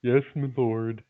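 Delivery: servile game-peasant reply; Warcraft peasant voice. Acted. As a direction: answer like a humble RPG peasant. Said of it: "the peasant voice from Warcraft".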